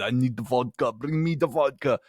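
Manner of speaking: hammed-up Russian accent; thick Russian accent. Reviewer mocking a spy's sudden accent change, thick Russian accent. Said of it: "with the thick Russian accent".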